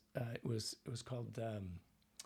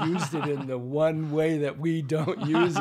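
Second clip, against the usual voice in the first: laughing, louder and higher; 4 semitones higher, 13 dB louder than usual. Real, not acted. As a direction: laugh through the punchline.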